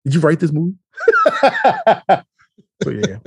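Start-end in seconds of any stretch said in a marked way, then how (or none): 1.0-2.2 shrieking high burst of laughter. Real, not acted. Retelling the movie night to his cohost, shrieking with laughter. Laughs after "did you write this.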